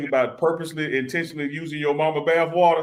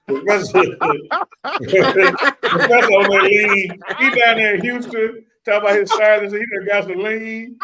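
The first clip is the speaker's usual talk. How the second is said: high, loud laughing tease; 7 semitones higher, 7 dB louder than usual. Real, not acted. Roasting his cohost about the lean, laughing high and loud. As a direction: crack up while roasting him.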